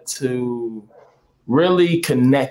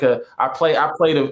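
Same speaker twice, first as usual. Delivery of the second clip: ordinary talk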